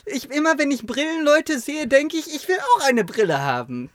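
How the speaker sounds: put-on high pleading kid voice; whiny child. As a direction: beg like a little boy wanting glasses. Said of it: "ich war richtig am Quengeln".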